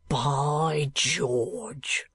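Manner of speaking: slow rough-spoken keeper, lightly voiced; a plain working man, unhurried; lightly voiced asylum keeper. Keeper exclaims.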